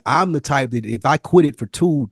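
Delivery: ordinary talk, nothing marked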